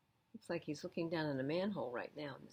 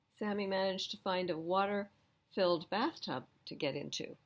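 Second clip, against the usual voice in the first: ordinary delivery, nothing marked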